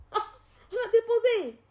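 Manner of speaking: sudden high shout; indignant high shout; loud outburst. Real, not acted. Angry outburst on a live.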